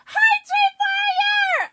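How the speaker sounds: high, slow, loud delighted hello; real joyful shout; excited shout. Real, not acted. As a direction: sing out hi to the raider. Greeting the raid leader.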